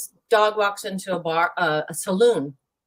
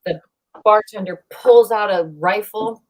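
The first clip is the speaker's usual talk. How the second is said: normal talking voice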